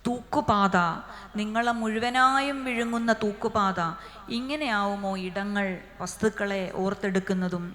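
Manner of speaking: quick, measured poetry recital; poem reading. Acted. Host reading a Malayalam poem to the audience, recital voice. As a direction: read the lines like a poem to an audience.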